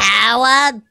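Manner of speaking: high, piercing call for Howard; Big Bang Theory yeller. Acted. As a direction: holler like a sitcom character. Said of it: "like, the one from The Big Bang".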